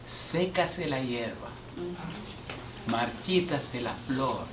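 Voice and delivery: slow, soft, solemn reading; solemn scripture reading. Acted. Pastor to congregation, reading Isaiah slowly. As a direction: let each phrase settle.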